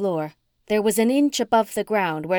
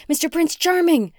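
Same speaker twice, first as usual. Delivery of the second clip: high, loud scream for her cat; frantic scream; 9 semitones higher, 4 dB louder than usual. A narrator's reading. Heroine screaming for her lost cat in the fire, high and loud. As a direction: yell his name into the smoke in panic. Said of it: "I screamed".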